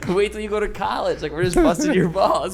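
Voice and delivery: high, loud words broken by laughter; laughing while talking. Retold or imitated. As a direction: laugh through the reveal of the prank. Retelling prank reveal, laughing.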